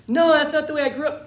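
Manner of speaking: high-pitched, very loud objection; loud mock protest. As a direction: blurt it out as an indignant objector, hammed up. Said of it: "people will say like".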